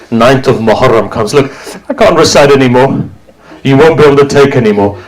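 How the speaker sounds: normal talking voice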